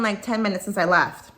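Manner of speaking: normal talking voice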